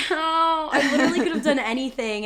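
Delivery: high, loud stunned "Oh"; shocked oh. Retold or imitated. Cyclist shouts at her, she reacts stunned. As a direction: gasp it out, caught off guard. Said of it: "and I was like".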